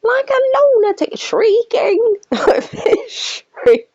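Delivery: high, laughing mock shriek; high squealing parody of Nick, breaking into a laugh. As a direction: send up his ranting in a high squeal. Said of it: "ranting and raving".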